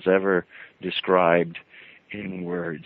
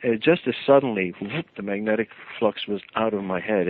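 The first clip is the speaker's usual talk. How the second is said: usual speaking voice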